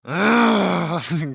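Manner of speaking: long, loud growl of rage; drawn-out angry growl; enraged growl. A narrator's reading. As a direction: growl out your fury. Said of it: "Growls Lynn with rage".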